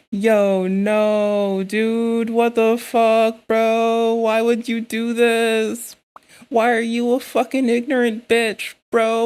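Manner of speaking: loud exasperated rant, dragging out "what the fuck"; outraged yelling. Real, not acted. Streamer scolding the video creator, loud outraged disbelief.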